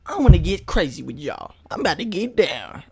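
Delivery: hammed-up hype intro with loud yos; put-on hype talk. Vlogger greeting viewers to get crazy, put-on hype. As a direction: open loud and exaggerated, playing it crazy. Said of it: "why I'm talking like this".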